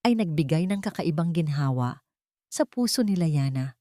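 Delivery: ordinary delivery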